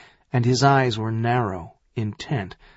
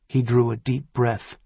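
plain reading voice

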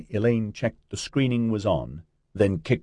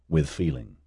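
ordinary delivery, nothing marked

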